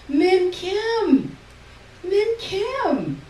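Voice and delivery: high, loud eager call; a mother calling out to the man in front on the tram. A narrator's reading. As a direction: call his name over the crowd. Mother tapping a man's shoulder.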